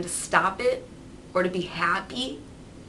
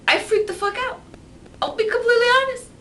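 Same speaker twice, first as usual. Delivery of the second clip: high, loud and rushed; worked up; 6 dB louder, faster than usual. Real, not acted. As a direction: blurt it out fast in a panic. Describing panic.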